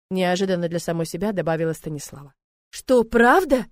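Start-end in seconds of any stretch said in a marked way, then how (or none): 2.7-3.6 delighted. A narrator's reading. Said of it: "обрадовался он".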